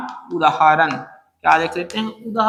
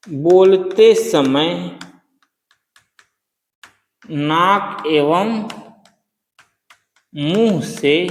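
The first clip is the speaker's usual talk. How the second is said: very slow, word by word with long pauses; slow dictation; 3 semitones lower, much slower than usual. Shown as a demonstration. Teacher dictates the definition slowly for notes. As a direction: dictate it so students can write. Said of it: "dictating a definition slowly".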